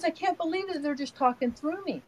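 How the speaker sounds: high-pitched retold disbelief; imitating grandson. Six-year-old grandson marvelling to his Nana, imitated disbelief. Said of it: "He goes".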